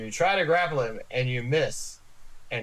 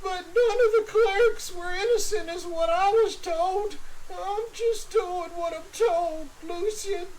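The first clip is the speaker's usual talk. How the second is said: high, tearful confession; crying Clevis. Acted. Clevis to his captor, high and weepy. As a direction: sob it out while justifying.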